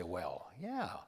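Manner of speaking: low, soft Reagan "Well"; soft impression of a Reagan "Well"; Ronald Reagan's "Well". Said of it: "He does say".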